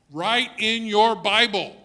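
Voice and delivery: loud, high and slow command; emphatic exhortation. Real, not acted. Preacher urging congregation to write in their Bibles, emphatic. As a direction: drive each word home, slowly and loudly.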